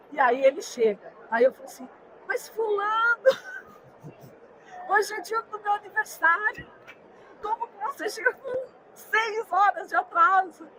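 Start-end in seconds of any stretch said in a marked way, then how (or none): 2.3-10.7 cobrança em tom bem mais agudo; bronca indignada recontada. Retold or imitated. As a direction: reencene uma bronca aguda e indignada. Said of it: "eu tava brava pra caramba". (bronca indignada, em voz aguda)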